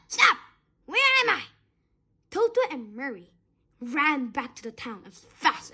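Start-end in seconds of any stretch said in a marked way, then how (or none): 0.1-1.4 1 dazed cry. A narrator's reading. Dazed crocodile cries out.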